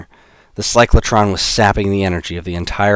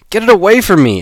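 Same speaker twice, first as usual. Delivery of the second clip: high, loud panicked cry of disgust; 13 semitones higher, 9 dB louder than usual. Acted. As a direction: shriek it away in fear. Alex recoils from rat.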